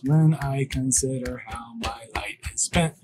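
slow, even read, each syllable counted off; counting syllables. Shown as a demonstration. Teacher counting syllables for students, slow and even. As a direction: tick off every syllable slowly. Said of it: "I just count out to make sure it's got ten syllables".

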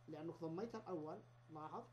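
ordinary delivery

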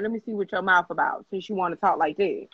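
ordinary talk

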